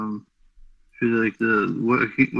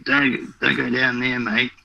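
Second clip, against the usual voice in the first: put-on Australian accent. Friend spooking the host, in an Australian accent. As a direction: warn them off like an old Aussie local. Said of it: "you gotta make it all Australian".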